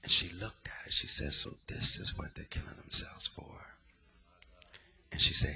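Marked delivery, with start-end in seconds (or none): 1.3-3.8 breathy whisper, barely voiced; whispered quote. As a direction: whisper it in disbelief. Retold quote from a woman.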